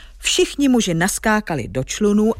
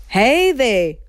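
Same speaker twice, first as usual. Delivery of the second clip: high, drawn-out giant's holler; storybook giant calling out; giant's booming call; 5 semitones higher, 3 dB louder than usual. Acted. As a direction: hail them like a thundering giant. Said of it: "houkl, a znělo to, jako když hrom burácí".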